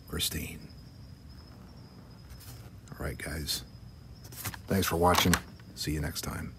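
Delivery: hushed late-night voice; whispering. Real, not acted. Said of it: "Sorry about the whisper".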